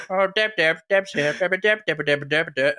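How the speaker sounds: put-on repetitive name-calling; mimicked TV characters. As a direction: babble the name in an imitated character voice. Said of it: "I go".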